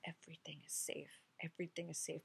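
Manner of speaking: soft whispered reassurance; whispered mantra. Shown as a demonstration. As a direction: breathe the words out as a mantra. Closing mantra after prayer.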